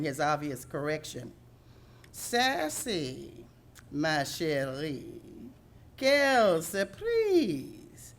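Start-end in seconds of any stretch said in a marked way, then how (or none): 2.1-8.1 put-on slow, higher, hammy French accent; theatrical French goose greeting; broken French accent. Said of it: "he said in his broken French accent".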